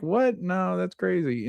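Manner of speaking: high-pitched, imitated disbelief; mock startled denial. Couple told to just get married, mock startled denial. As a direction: squeak the question, then brush it off.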